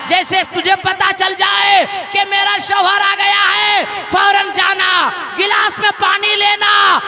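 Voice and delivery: loud, ringing sermon delivery, rising in pitch; impassioned preacher's cry; full-force preaching. Acted. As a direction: preach the miracle story to a big crowd, building to a drawn-out high climax.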